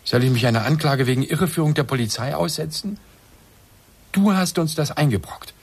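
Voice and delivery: husband blaming wife, rising indignation; rising anger. Acted.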